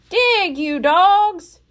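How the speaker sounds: loud, slow drawn-out command; Captain Bones shouting at his men; shouted. A narrator's reading. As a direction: bellow each word at the crew. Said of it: "cried Captain Bones".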